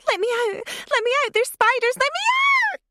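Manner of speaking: put-on trapped girl shrieking in panic; terrified screaming. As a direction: scream to be let out, genuinely terrified. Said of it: "The pitch of the voice inside the box distorted".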